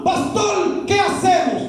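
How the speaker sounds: a hint of a worried believer asking the pastor for help; imitating a worried congregant. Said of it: "abro un signo de interrogación".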